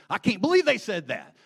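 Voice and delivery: high, loud mock outrage; fired up. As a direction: burst out as a worked-up news reader. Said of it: "getting all fired up".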